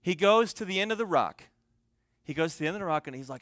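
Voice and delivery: usual speaking voice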